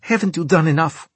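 high, exasperated retort; angry. A narrator's reading. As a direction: throw it back at him, fed up. Angry superior confronting him.

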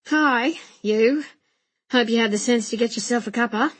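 quick, casual coachman's banter. Acted. Coachman greeting young gentleman, casual and quick. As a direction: toss it off as a matey coachman.

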